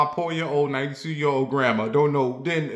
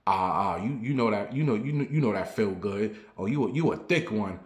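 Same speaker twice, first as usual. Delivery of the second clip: lecherous mumble; 4 semitones lower, 5 dB quieter than usual. Retold or imitated. Old man groping teller.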